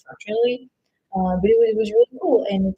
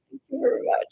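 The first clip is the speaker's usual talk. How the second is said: whisper; 6 semitones higher than usual. Real, not acted. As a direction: breathe the thanks out in a whisper. Whispered thanks to viewer.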